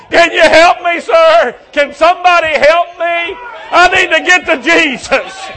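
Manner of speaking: loud, high, pleading shout of a blind man; desperate cry for help. Retold or imitated. Blind man begging the crowd to get to Jesus, pleading shout. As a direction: call out over the crowd like you're lost and groping. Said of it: "He said".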